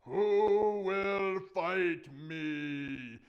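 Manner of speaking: very slow, booming challenge; loud, drawn-out dare; giant's voice. Acted. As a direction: stretch each word in a big bellow. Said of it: "then he hears this voice".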